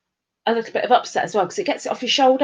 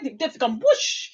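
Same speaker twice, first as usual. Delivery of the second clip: normal talking voice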